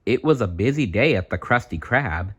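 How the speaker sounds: ordinary delivery, nothing marked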